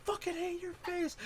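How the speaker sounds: high, hushed mock yell; softened squeal of a retold shouting match. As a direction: imitate yelling without the volume, very high. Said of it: "and they're yelling".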